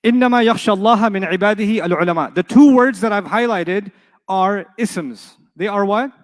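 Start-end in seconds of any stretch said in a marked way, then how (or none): 0.0-2.3 louder quick run through the ayah; rapid recitation. Acted. Teacher racing through the ayah with the class, quick and loud. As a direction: rattle the verse off fast. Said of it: "let's do this exercise together".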